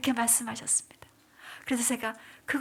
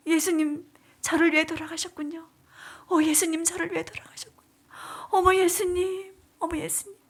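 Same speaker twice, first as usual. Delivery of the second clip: high, tearful cries to Jesus; retold sobbing realization; weeping prayer; 5 semitones higher, 3 dB louder than usual. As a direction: pour out grief and wonder. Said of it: "하고 제가 또 울면서".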